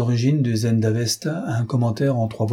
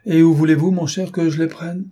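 high-pitched indignant protest, building louder; affronted great man objecting; indignant exclamation; 6 semitones higher, 3 dB louder than usual. A narrator's reading. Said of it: "S'écria le grand homme d'un air indigné".

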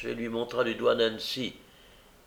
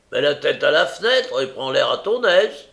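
loud, raised-pitch dismissal; angry refusal; 4 semitones higher, 8 dB louder than usual. Acted. Brigand refuses to let Nancy go out, angry. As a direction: order her roughly, no sympathy.

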